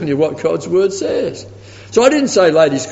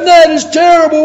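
high, loud shocked outburst; mock horrified protest, pitched up and loud; retold outrage; 12 semitones higher, 7 dB louder than usual. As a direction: blurt it out in high indignation. Angry man retold in sermon.

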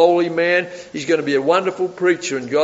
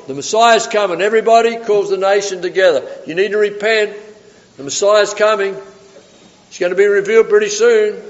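raised, louder preacher's proclamation; calling out; 5 semitones higher, 4 dB louder than usual. Retold or imitated. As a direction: call the crowd to attention, pitched up. Prophet calling out to the nation.

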